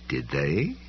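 low, soft polite question; understated politeness. A narrator's reading. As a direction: ask it with quiet, cool courtesy. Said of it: "she asked politely".

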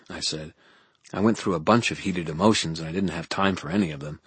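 ordinary delivery, nothing marked

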